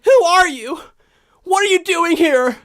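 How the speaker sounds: panicked guy demanding answers; truck driver voice. Pretend. Truck driver confronts intruder.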